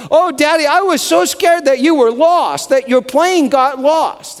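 put-on high, loud, breathless little girl; child's squeal of relief; little girl's voice. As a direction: play the scared three-year-old running to daddy. Child greets dad at airport.

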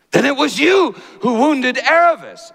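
high, loud startled exclamation; exclaiming. Acted. Shasta to the lion, loud startled exclamation. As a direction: burst out in shock as the boy. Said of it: "exclaimed Shasta".

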